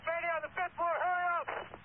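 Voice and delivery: high, pressed radio distress call; urgent Mayday call. Real, not acted. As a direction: plead for help, hurry up. Second Mayday over radio.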